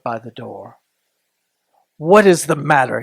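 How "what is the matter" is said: usual speaking voice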